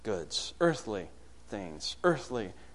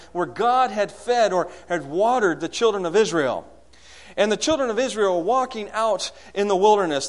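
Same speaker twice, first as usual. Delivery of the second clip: higher, louder sermon storytelling; impassioned preaching; 7 semitones higher, 7 dB louder than usual. Real, not acted. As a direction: drive the Bible story forward with force.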